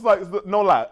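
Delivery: ordinary talk, nothing marked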